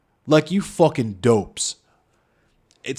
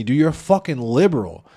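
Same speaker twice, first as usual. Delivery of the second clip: usual speaking voice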